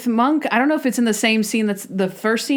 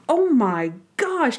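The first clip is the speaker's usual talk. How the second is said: drawn-out, higher-pitched mock shock; shocked reaction; 5 semitones higher, slower than usual. Reacting to a painful knock on screen, shocked and drawn out. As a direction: stretch it out in horrified sympathy.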